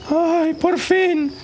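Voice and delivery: very slow, high drawn-out cry; relieved triumph. Acted. The mouse finally reaches the moon, slow high cry. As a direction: stretch it out in relief.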